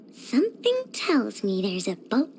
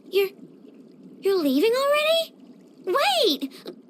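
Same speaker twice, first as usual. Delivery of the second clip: dismayed surprise; 4 semitones higher than usual. Acted. Friend is leaving.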